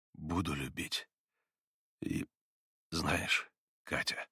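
soft, halting, breath between words; catching breath. A narrator's reading. Mitya vows love to Katya, catching his breath. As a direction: answer quietly, pausing for air. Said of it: "переводя дух на каждом слове".

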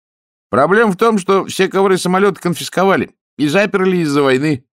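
high, loud bystander; a loud, high onlooker; voice from the crowd. Acted. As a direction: call it out from the crowd. Crowd voice explains.

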